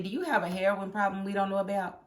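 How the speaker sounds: usual speaking voice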